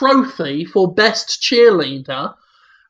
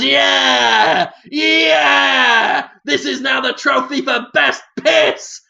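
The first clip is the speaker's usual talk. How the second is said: mock triumphant shout; 4 semitones higher, much slower than usual.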